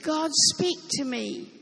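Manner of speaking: ordinary talk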